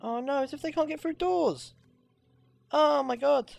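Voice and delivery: high-pitched exclamation of dismay; exasperated dismay. Real, not acted. Player finding horses can't get through doors, exasperated dismay.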